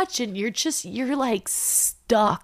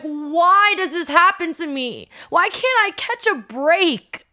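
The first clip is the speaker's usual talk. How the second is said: higher and louder, despairing cry; retold anguished outburst; 8 semitones higher, 5 dB louder than usual. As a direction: cry out at the unfairness of it. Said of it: "I was so mad".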